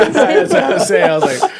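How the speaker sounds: high laughing breathy words; cracking up. Real, not acted. Cracking up at his friend eating pizza, laughing. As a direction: let the laugh take over the words.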